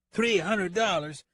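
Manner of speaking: high, drawn-out outraged echo; shocked disbelief. Acted. Deputy nearly leaves his chair at the fee, shocked.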